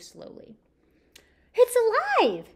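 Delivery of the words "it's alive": much higher, louder "It's alive!"; joyful shout. Acted. Simon sees the fish move, joyful shout. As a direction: shout with sudden joy. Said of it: "shouted Simon".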